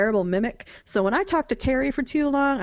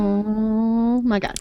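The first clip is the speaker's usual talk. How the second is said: long drawn-out swoon; dreamy, lingering fan swoon; much slower than usual. Real, not acted. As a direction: stretch it out slowly, swooning. Swooning over Dean driving Baby.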